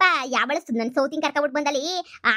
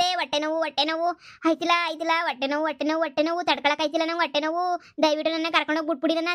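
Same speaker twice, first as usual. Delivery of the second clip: faked stomachache; 3 semitones higher than usual. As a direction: overplay the agony to get out. Prisoner fakes pain in jail.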